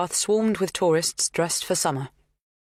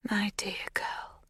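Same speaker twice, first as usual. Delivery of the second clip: breathy whisper, slow and tender; soft whisper; 5 semitones higher, 8 dB quieter, slower than usual. A narrator's reading. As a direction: whisper it gently to the girl. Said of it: "she says softly".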